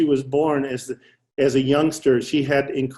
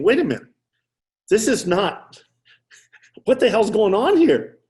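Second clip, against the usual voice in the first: rising amazed disbelief, higher pitch; astonished; 7 semitones higher, 3 dB louder than usual. Retold or imitated. Doctor realizing his stroke patient talks fluently, amazed. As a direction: caught off guard, voice climbing.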